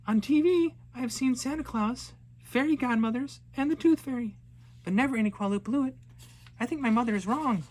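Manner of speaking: put-on high, fast, bright little girl announcing plans; eager young girl. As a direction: play the excited girl set on fishing. Girl talks to herself.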